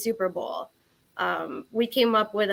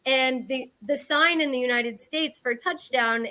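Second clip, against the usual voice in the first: ordinary talk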